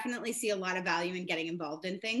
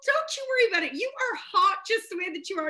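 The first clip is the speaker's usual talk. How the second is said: high, loud gushing reassurance; emphatic hype-up; 9 semitones higher, 5 dB louder than usual. Retold or imitated. Shopper reassuring older woman who lost her hair, gushing. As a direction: retell a big compliment with sassy conviction.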